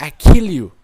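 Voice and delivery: hammed-up Japanese accent, shouted; mock Japanese accent. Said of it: "she'll literally sit there and be like".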